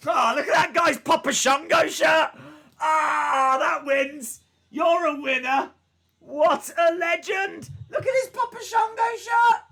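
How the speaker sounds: loud, high delighted yelling; excited shouting. Real, not acted. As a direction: shout with joy at the fan. Thrilled by fan's shirt.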